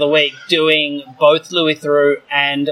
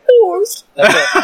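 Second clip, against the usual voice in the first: high, strained dying Bubba voice; Bubba from Forrest Gump; 4 dB louder, slower than usual. Retold or imitated. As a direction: do Bubba gasping after he's shot. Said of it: "I can do Bubba from Forrest Gump when he gets shot".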